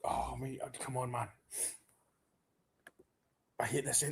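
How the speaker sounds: barely able to watch; nervy fan muttering at the end; tense under breath. Real, not acted. Tense fan in stoppage time.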